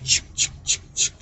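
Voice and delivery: whispered chick chick like a shutter; camera click imitation. Hearing paparazzi behind the fence, camera click imitation. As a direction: mimic a camera's clicking under your breath.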